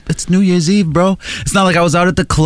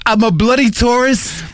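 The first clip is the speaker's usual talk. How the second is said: higher mock British voice; British tourist; 6 semitones higher than usual. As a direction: parody a British tourist's accent. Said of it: "old guy with a British accent".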